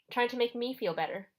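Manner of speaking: ordinary delivery, nothing marked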